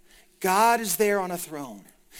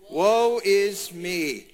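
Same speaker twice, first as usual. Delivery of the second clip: very slow, drawn-out recitation; leading recitation; much slower than usual. Acted. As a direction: stretch each word for the congregation to join in. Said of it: "would you say it with me".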